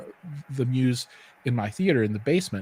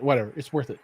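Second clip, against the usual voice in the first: ordinary delivery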